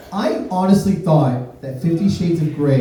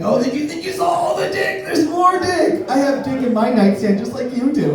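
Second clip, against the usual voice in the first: high, hammed-up voice yelling there's more; mock boastful dick voice; 10 semitones higher than usual. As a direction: brag like a showman, way up high.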